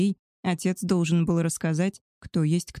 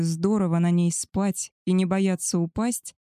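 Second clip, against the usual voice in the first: plain reading voice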